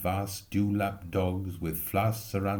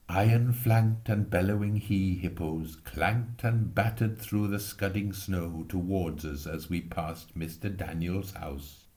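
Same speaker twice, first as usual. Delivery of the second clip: plain reading voice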